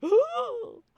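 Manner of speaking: sharp gasp, then a high loud ooh; shocked audience gasping; crowd gasp. Retold or imitated. Crowd reacting to the gunshot, gasping high and loud. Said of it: "and the crowd going".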